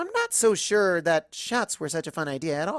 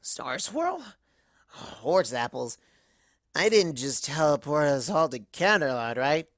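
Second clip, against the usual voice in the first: lower, slurring bewildered mare; tipsy Twilight; 3 semitones lower, slower than usual. Acted. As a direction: sound muddled and a bit drunk.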